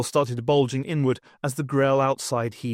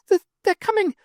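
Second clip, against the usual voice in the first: much higher, loud stammering rodent professor; panicked shout; 5 dB louder than usual. A narrator's reading. Warrender warning the sleeping academics, high panicked shout. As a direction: squeak it out in terror. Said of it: "shouted Warrender".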